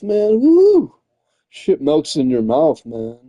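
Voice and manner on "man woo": sudden high whoop of delight; food-lover's joyful cheer. Real, not acted. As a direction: let out a happy woo over the taste. Savouring favourite roast beef.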